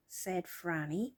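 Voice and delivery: ordinary delivery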